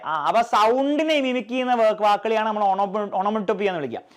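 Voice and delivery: ordinary delivery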